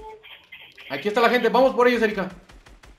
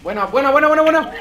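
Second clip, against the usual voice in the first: high, loud cheer of triumph; excited cheering; 6 semitones higher, 5 dB louder than usual. Real, not acted. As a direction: shout it out, thrilled with the kill. Cheering a kill in game.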